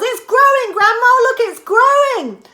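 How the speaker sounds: high, loud excited yell; high loud boy's excited yell; George yelling. A narrator's reading. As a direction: yell it to Grandma.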